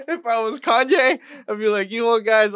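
high, loud, cracking up through the words; laughing while talking. Real, not acted. Comic imagining himself as [name], laughing while talking. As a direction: push the setup out through real laughter.

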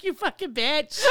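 high, slow curse through laughter; laughing imitation of road rage; mock angry driver. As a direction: curse like the driver behind, cracking up. Imagined angry driver, told while laughing.

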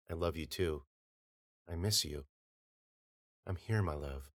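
soft, hushed loving voice; tender wife. A narrator's reading. His wife Melanie tells Bennett she loves him, softly. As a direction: murmur it like a longed-for wife.

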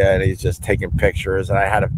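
ordinary talk, nothing marked

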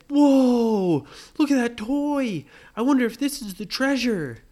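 Brady thrilled at finding a toy, excited; excited kid. Acted. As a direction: gush like a kid seeing a toy.

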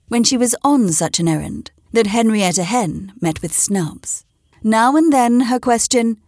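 ordinary delivery

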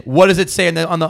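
ordinary talk, nothing marked

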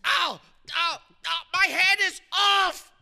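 loud, high cries of pain; pained yelling. Acted. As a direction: yell out as if hit hard. Head punched off in fight.

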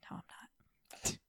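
slow unvoiced whisper. Real, not acted. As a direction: whisper it to yourself. Self-deprecating aside.

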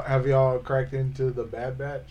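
ordinary talk, nothing marked

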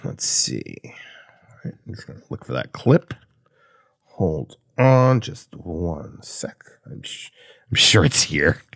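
low mumbled searching; muttering to self. Pretend. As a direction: mutter quietly while hunting. Pretends to search for clip.

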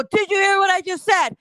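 high, loud parental challenge; demanding mother. Acted. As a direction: demand an answer from your children. Mother voiced demanding an answer.

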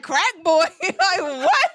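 high, laughing in disbelief. Real, not acted. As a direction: repeat it like you can't believe it. Incredulous retelling, laughing.

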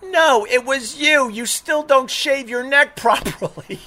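high, insistent, breaking into laughter; mock accusation. Telling the owner he remembers him, amused mock accusation. Retold accusation, cracking himself up.

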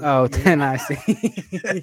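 slow words broken by laughter; cracking up while saying it; laughing through the punchline. Real, not acted. Laughing at own pickup line.

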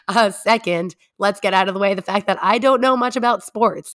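usual speaking voice

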